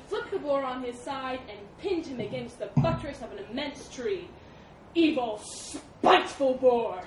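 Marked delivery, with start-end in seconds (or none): 4.9-7.0 loud, slow venomous insult; spitting fury. A narrator's reading. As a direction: spit each word at the boar. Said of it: "spat the elephant".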